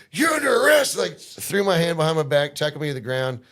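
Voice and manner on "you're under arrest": loud arrest shout. Retold or imitated. Cop tackling him, retold.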